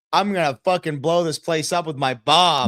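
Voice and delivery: hammed-up loud threat; mock threat. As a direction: protest loudly with an exaggerated threat. Acts out threat he never made.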